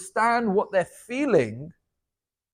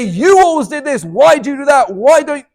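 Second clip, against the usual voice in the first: louder, higher accusing shout; blaming shout; 7 semitones higher, 10 dB louder than usual. Retold or imitated. Partner shouting blame at the one who hurt them. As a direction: hurl the blame instead of saying ouch. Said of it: "'cause you're just shouting".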